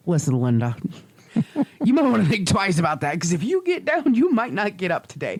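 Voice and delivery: body talking back. Retold or imitated. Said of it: "my body grabs me and goes".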